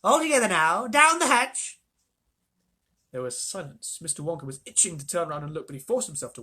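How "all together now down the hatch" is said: high, loud rallying call; old voice calling a toast; hearty toast. A narrator's reading. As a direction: raise the glass and call it. Old man calls the toast.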